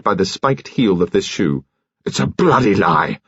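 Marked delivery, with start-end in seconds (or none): 2.0-3.2 bawled out. A narrator's reading. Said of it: "George Lacklander bawled out".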